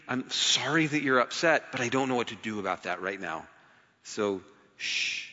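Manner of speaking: mild hush. Retold or imitated. Jesus gently answering critics.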